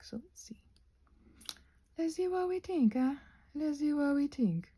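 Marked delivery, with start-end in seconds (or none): 2.0-4.7 a lightly put-on higher voice; playful voice. Asking viewers what they think, lightly put-on voice. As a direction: toy with the line in a funny voice. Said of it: "we're doing all kinds of different voices".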